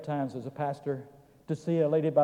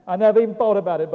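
quick, loud and higher; emphatic repeat; 5 semitones higher, 9 dB louder, faster than usual. Real, not acted. Preacher repeating his confession to the folks, emphatic. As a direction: burst out with it, fast and forceful.

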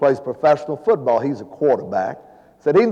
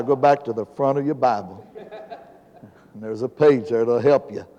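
ordinary talk, nothing marked

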